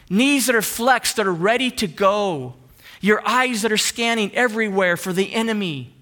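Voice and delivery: preaching. Acted. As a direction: build the image of a soldier standing firm. Preaching about standing firm.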